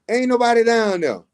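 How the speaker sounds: ordinary talk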